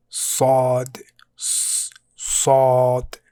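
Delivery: "sod" with a heavy S from the back of the tongue; strong back-of-tongue S in "sod", the S also held alone; strong emphatic S. Shown as a demonstration. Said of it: "like a really strong S sound".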